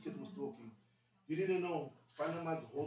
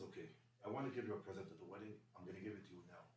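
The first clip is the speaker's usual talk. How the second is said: much softer, low murmur; hushed night talk; 5 semitones lower, 8 dB quieter, faster than usual. Retold or imitated. Father waking his son at two in the morning, hushed. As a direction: keep it down, everyone's asleep.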